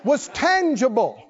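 high, rhythmic preaching building in excitement; rousing testimony from the pulpit; fired-up preaching. Real, not acted. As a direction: preach it to a cheering congregation, piling up phrases. Preaches to the congregation at full force.

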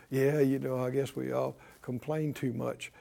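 low, soft mock admission; casual shrug-off. Folks brushing off talk about complaining, casual shrug-off. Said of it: "people kinda laugh and go".

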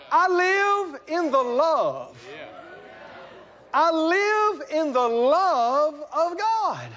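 slow, drawn-out high proclamation; exultant declaration. Acted. Believer proudly answers where he lives.

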